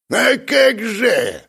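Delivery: high, drawn-out gleeful cry; exultant, stretched exclamation; joyful. A narrator's reading. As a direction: agree with showman's relish. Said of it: "радостно кивнул Гиджин".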